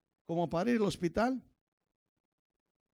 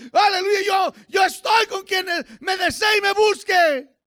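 high, loud revival preacher; impassioned pulpit cry in raised pitch; full-force preaching; 9 semitones higher, 7 dB louder than usual. Acted. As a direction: preach it hard, drawing out key words.

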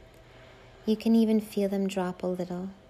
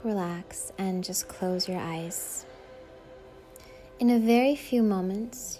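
ordinary delivery

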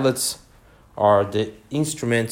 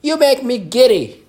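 high, loud, irritated shriek; screamed; 13 semitones higher, 8 dB louder than usual. A narrator's reading. The Queen screams at the bowing gardeners. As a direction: keep screaming in annoyance. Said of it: "screamed the queen".

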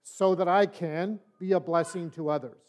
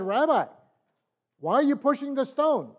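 higher, mocking tempter's question; devil's taunting voice; 8 semitones higher, 5 dB louder than usual. The devil questions the rabbi at the stone, mock taunt. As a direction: needle him like a sly devil.

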